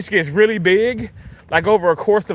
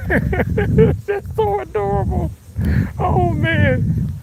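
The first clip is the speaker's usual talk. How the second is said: giggling; 10 semitones higher, much slower than usual. Real, not acted. Laughing at kid running on video.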